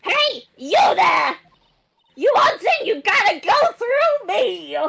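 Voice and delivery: loud, slow yelling security guard; loud, slow barked warning from a gate guard; screaming guard. Acted. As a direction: bellow at the intruders. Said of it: "I will be screaming".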